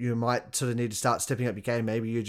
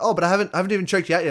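opponent surprised; 6 semitones higher, 4 dB louder, much faster than usual. Retold or imitated. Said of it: "it's like".

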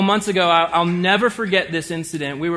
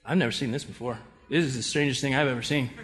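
lower, softer, half-laughing aside; stunned murmur; 5 semitones lower, 7 dB quieter, faster than usual. Retold or imitated. Players watching the awkward standoff, soft bewildered murmur. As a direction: mutter to the guy beside you.

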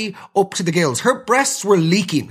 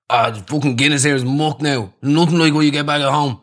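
put-on lichtjes nagedaan Dublins accent van klagende jongens; mock Irish lad. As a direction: een vleugje Foxrock-accent, zeurend over de Guinness. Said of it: "complaining about the pints of Guinness".

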